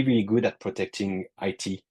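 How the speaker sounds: usual speaking voice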